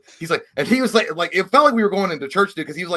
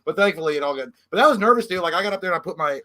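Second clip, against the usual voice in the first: ordinary talk, nothing marked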